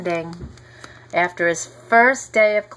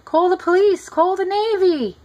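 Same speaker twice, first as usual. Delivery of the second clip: very high, frantic cries for help; panicked yelling; 12 semitones higher, 4 dB louder than usual. A narrator's reading. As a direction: shout as if the pool is under attack. Coach panicking at the creature.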